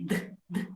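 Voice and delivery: D, D as bare voiceless tongue taps; just the tongue tap of D, whispered; whispered phonics D. Shown as a demonstration. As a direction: som do D sussurrado. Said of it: "vou fazendo o som".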